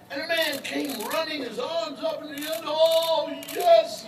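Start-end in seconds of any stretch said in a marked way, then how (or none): 2.6-4.0 high, loud joyful call; excited cry. Acted. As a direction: cry out as if you've seen a miracle. Man running with arms up.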